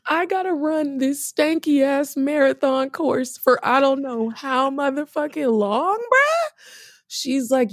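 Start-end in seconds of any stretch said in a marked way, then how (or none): 0.1-6.5 high, slow, incredulous whine; outraged disbelief. Retold or imitated. Arguing with the doctor about the stanky marathon, high and incredulous. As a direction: stretch it out in stunned disbelief, ending on a squeak.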